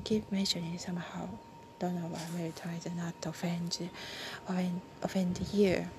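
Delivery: quick unvoiced mumbled aside; fast whisper. Real, not acted. Aside to a listener while reading, quick whisper. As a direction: rush it under the breath.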